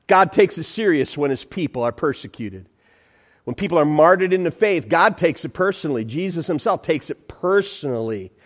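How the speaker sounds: ordinary talk, nothing marked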